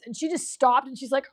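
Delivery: ordinary delivery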